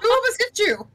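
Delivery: surprised. Real, not acted. Alarmed question.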